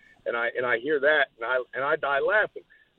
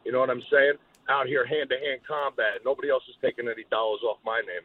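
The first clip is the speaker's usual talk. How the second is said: ordinary delivery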